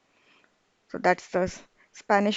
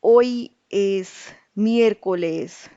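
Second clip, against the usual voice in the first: hoy es miércoles drawn out slowly, word by word; slow clear model answer; 5 dB louder, much slower than usual. Shown as a demonstration. Teacher giving the model answer slowly and clearly. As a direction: say it slowly for learners to copy. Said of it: "our answer would be".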